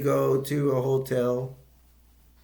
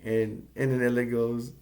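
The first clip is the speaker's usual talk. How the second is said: usual speaking voice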